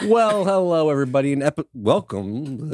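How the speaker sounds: upbeat show host greeting; podcast host intro. Acted. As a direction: open the show brightly. Hosts podcast opening.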